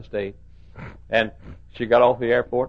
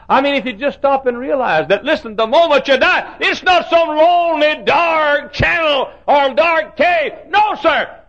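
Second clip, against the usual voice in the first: high, loud revival preaching, slowing to a shout; preaching at full force; 9 dB louder, slower than usual. Acted. Preacher rousing the congregation about death, full-force preaching.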